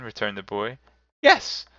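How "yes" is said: put-on very high, loud little-girl squeal; child brimful of glee. A narrator's reading. Little sister answering her brother, squeakily gleeful. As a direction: pipe up gleefully. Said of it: "brimfully of glee".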